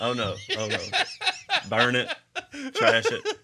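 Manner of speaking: loud laughing pleas; laughing mock panic. Real, not acted. Host begging to destroy the blooper reel, laughing. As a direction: beg through laughter.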